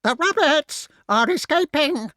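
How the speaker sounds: very high, loud crow screech; piercing squawked warning; shrieking crow. A narrator's reading. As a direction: shriek it as an alarmed bird. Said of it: "shrieked the crow again".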